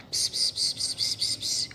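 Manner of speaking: hushed whispered "ps, ps"; whispering a secret. Acted. Maluquinho telling Juninho a secret, whispering. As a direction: whisper gibberish as if into an ear. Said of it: "Ele está falando baixinho".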